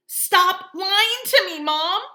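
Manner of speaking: very high, loud, slow shriek. Acted. Augie shrieks at Mom to stop lying. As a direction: shriek it at your mother. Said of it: "I shrieked".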